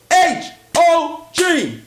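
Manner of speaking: H O G said letter by letter, slowly; slow, emphatic spelling of H O G; spelled out. Shown as a demonstration. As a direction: spell H O G out deliberately. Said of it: "spelling out hog".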